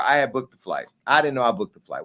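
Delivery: ordinary talk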